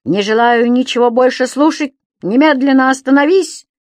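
loud, high order; angry commanding shout. Acted. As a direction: bark it to stop him. Daume orders creature to stop.

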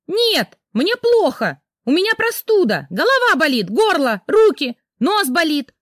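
high, loud hysterical cry; wife screaming she is sick; hysterical shouting. Acted. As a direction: shriek out every complaint. Rita screams refusal at husband.

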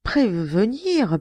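slow, halting, stunned repetition; stammering. A narrator's reading. Lecoq stunned by the news, slow halting stammer. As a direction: stumble over the words in disbelief. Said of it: "balbutia Lecoq".

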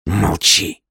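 hard, sharp command; steely command. A narrator's reading. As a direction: cut him off like a blade. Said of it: "в голосе чужанина лязгнула сталь клинка".

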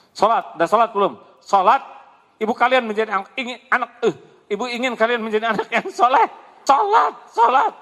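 loud, high, fast scolding about prayer; nagging mother. Retold or imitated. A mother nagging her children to pray, loud high scolding. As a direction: bark at the kids like an impatient mother.

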